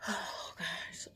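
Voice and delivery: overwhelmed, breath-only whisper; shaken whisper. Real, not acted. To herself after the injection, in a shaken whisper. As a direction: whisper it as the nerves catch up.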